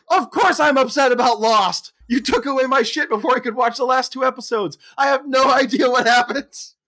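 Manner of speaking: put-on high, loud, whiny teenage complaint. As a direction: whine it like a teen robbed of her show. Daughter complains about missing Lost.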